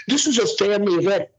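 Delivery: high mocking imitation of the official; mocking quote. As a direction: parrot him in a high sneering voice. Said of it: "how are you gonna sit there and tell me".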